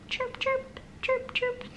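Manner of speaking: very high, tiny chick chirps; chick chirping. Acted. Mother voicing the chick in the story, very high chirps. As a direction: chirp it like a little baby chick. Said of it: "chirping chick".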